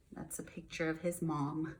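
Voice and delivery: usual speaking voice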